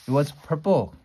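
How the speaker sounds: ordinary delivery, nothing marked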